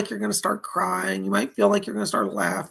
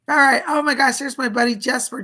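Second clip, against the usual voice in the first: louder, higher burst of delight; excited shout-out; 4 semitones higher, 7 dB louder than usual. Real, not acted. Host thanking a viewer for a donation, excited. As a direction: greet a donor with a sudden lift.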